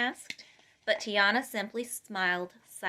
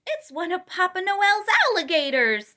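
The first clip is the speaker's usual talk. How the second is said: high, loud delighted exclamation; excited; 8 semitones higher, 6 dB louder than usual. A narrator's reading. A guest spotting Louise at the party, excited high exclamation. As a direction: blurt it out, thrilled by the gator. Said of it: "was especially excited to see Louise".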